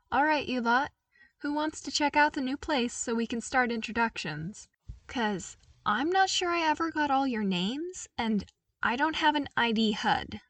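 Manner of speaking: put-on lighter, upbeat young voice; bright eager invitation; cheerful Luke. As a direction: friendly call to the troopers.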